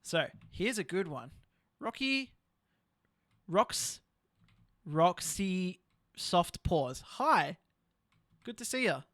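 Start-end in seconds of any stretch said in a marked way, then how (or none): 1.8-9.0 slow, stumbling over the name; halting reading. Real, not acted. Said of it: "Sorry, I struggled at reading that".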